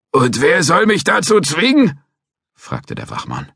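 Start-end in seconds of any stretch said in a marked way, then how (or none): 0.1-2.0 put-on a cranky old guard threatening to call police; old watchman.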